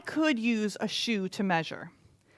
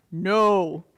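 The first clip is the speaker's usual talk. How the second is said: flat refusal; 3 semitones lower, 7 dB louder than usual. Acted. Debtor refuses again.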